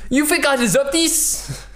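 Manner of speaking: hammed-up Japanese accent, student asking. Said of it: "Two of the worst Japanese accents".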